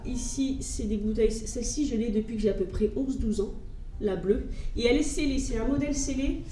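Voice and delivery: usual speaking voice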